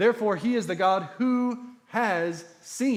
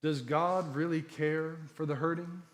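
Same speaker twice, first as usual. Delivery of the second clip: usual speaking voice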